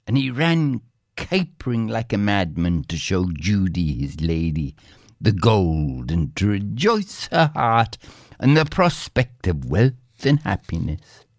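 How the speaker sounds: ordinary delivery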